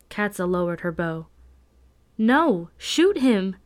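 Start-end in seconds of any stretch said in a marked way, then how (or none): 2.2-3.6 higher, louder desperate command; urgent shout. A narrator's reading. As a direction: cry out the order in urgent protest. Desperate order to shoot.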